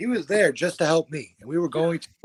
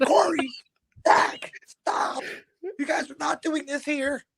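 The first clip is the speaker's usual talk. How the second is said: much higher, breathy mock dad voice; dad pleading; 13 semitones higher, slower than usual. Dad stepping between the two fighters, high nervous plea. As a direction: squeak it like a nervous little dad.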